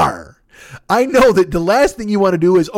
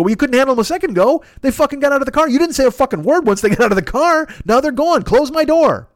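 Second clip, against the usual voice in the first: higher-pitched, heated retold rant; exasperated argument; 6 semitones higher than usual. Driver refusing to wait for the passenger, exasperated. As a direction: argue back fed up, voice pitched up.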